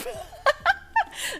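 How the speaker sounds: squeaky burst of giggling; high laugh. Real, not acted. Laughing after burping.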